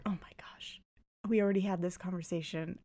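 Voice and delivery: whispered aside of dread; hushed, retold exasperation; exasperated whisper. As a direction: whisper it to yourself, fed up. Dreading second confrontation, private reaction.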